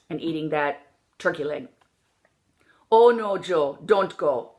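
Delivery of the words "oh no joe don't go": long tight O in "no", "Joe", "go"; ventriloquist O. Shown as a demonstration. Coach demonstrating like a ventriloquist, "no", "Joe", "go" unglided. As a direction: hold "go" as one pure, still-lipped O. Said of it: "I'm a ventriloquist".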